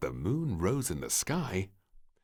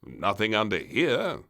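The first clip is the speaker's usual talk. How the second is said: king; 3 semitones higher, 7 dB louder than usual. Acted. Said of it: "said the King".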